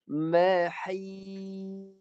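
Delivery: Mahis recited with the long vowel cut and sad stopped; teacher chants Mahis, the sad held still; recited demo. Shown as a demonstration. Teacher showing students a dead sad, recited. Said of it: "Dengar saya baca".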